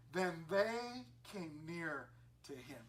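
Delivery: scripture read slowly and deliberately. Real, not acted. Preacher slowly reading the verse to the congregation. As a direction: linger over each word.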